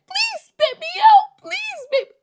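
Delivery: very high, loud squeaky begging; pleading gum voice. Pretend. The chewed gum begging her, high squeaky plea. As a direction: beg like a tiny desperate piece of gum. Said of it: "that gum would have been like".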